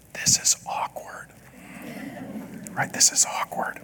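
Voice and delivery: hushed, breathy stage whisper; whispered aside. Acted. Pastor whispers aside on awkward scene.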